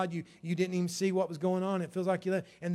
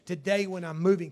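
ordinary talk

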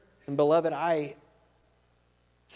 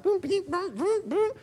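high-pitched machine booms and beeps; cartoonish assembly-line sound effects; imitated factory noises; 11 semitones higher than usual. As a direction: mimic a production line in quick, high bursts. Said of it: "coming out really fast and it's like".

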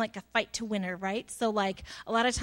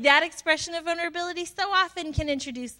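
ordinary talk

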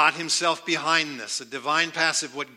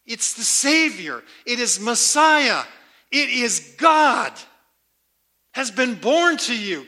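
louder, higher, slowing climax; rising proclamation; 4 semitones higher, 6 dB louder than usual. Acted. Preacher building to the climax for the congregation, loud. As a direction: build to a ringing declaration.

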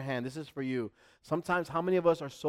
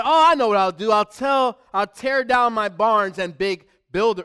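loud, higher, eager realization; excited rich man; 6 semitones higher, 10 dB louder than usual. Acted. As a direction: burst out with a bright idea, loudly. Rich man's sudden idea in parable.

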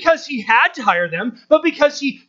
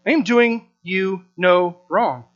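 gentle master; 6 semitones lower, 5 dB quieter than usual. Acted. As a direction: settle the grumblers with quiet authority. Master's calm reply to grumblers.

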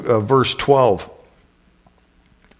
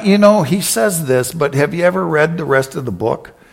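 ordinary talk

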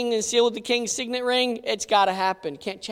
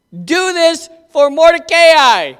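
slower, louder king's order; slow kingly command; 4 semitones higher, 8 dB louder than usual. Acted. King repeats the order to Haman, slow and loud. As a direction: lean on each word as a command.

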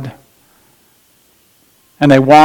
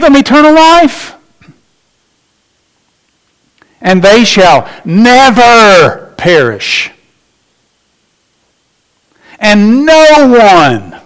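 slow, high, loud climax on "eternal life"; preacher soaring, then settling; fervent preaching; 6 semitones higher, 4 dB louder, slower than usual. Acted. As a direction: build to a ringing cry.

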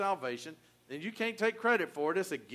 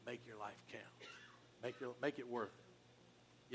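dying man's words; 5 semitones lower, 15 dB quieter than usual. Retold or imitated. Dying soldier to the man he saved, hushed. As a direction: whisper it low, like last words. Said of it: "what the guy told him right before he died".

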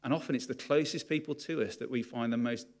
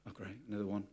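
bored; 8 dB quieter, faster than usual. Retold or imitated. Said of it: "a little bit more like".

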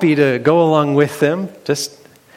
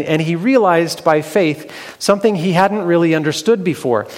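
ordinary delivery, nothing marked

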